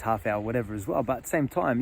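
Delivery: ordinary talk, nothing marked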